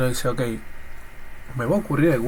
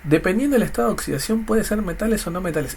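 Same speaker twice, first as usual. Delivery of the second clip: higher, faster puzzled student; confused student; 6 semitones higher than usual. Pretend. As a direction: rattle it back as a baffled student. Imagined student repeating the rule.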